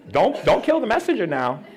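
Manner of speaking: ordinary delivery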